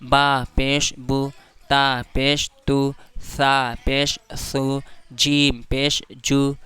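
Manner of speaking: each letter read out slowly; slow recitation demo. Shown as a demonstration. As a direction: recite slowly so the class can follow. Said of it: "जैसे".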